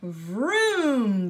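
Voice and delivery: loud zooming vroom; storyteller's loud vroom for the elevator; vroom sound effect. A narrator's reading. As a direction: make the elevator blast off.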